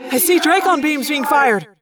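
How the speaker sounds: high-pitched alert; yelling down. Acted. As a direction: shout a warning to those below. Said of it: "Tobias yelled down from above".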